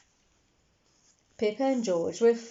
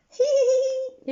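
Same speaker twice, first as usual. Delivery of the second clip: high squeaky giggle; giggling; 10 dB louder than usual. A narrator's reading. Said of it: "everyone giggled".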